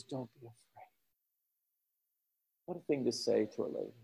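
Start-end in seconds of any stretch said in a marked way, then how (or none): 0.0-0.6 "Don't be afraid" said much softer and lower; tender low reassurance to the widow; gentle comfort. Acted. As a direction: soothe a grieving widow quietly.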